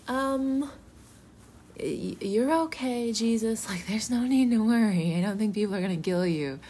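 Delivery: high-pitched, tentative reassurance; hesitant humouring. Retold or imitated. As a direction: humour someone gently, with a halting start. Second imagined Peter, humouring Jesus.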